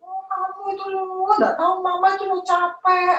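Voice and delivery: high, whiny mock complaint; high mock-whiny mother's complaint; complaining mother. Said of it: "Jangan mengeluh di hadapan anak-anak".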